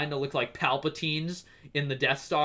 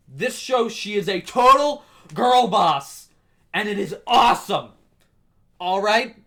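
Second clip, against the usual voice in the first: excited; 5 semitones higher, 8 dB louder than usual. Real, not acted. Hyping her as girl boss.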